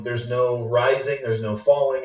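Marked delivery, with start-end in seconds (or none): none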